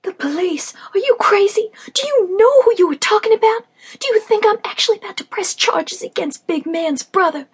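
high, loud disbelieving outburst; are you out of your mind; incredulous protest. Acted. Battered friend protests in disbelief.

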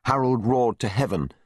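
usual speaking voice

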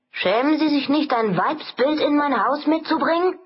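high-pitched indignant landlady; nagging, high-voiced rebuke; shrill scolding. A narrator's reading. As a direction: scold him in outrage, pitch soaring. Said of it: "zeterte sie".

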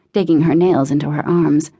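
plain reading voice

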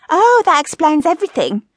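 high, sarcastic comeback; high-pitched comic scorn; sarcastic wife. A narrator's reading. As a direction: react as an unimpressed high-voiced wife. Wife answers husband's excuse.

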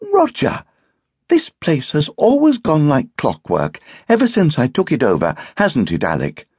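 high, loud wifely protest; indignant hostess. A narrator's reading. Wife defends her housekeeping to Roger, indignantly.

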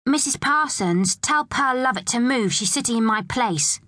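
high, whiny tattling; complaining schoolchild. A narrator's reading. Amy telling teacher to move Pearl, complaining schoolchild.

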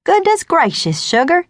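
high, gushing Texas twang; hammed-up Texas sweet talk; exaggerated Texas twang. A narrator's reading. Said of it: "in my most exaggerated twang".

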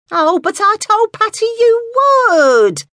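very high, slow, plaintive wail; drawn-out high plea. A narrator's reading. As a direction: wail it out like a let-down old lady. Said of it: "wailed Alice".

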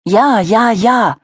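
very high, loud squeal of joy; a thrilled boy shrieking yes; excited shrieks, high and loud; delighted child shrieking. A narrator's reading. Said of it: "kreischte Max begeistert".

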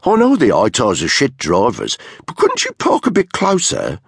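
high, mocking complaint; unpleasant sneer. A narrator's reading. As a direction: sneer at the driver, rising into the question. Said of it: "said with an unpleasant sneer".

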